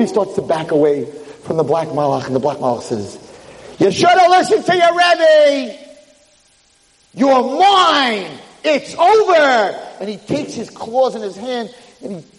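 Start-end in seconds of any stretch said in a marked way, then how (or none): 3.8-9.7 high, loud, slow villain cry; stretched, gloating devil shout. Retold or imitated. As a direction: pronounce doom with drawn-out relish.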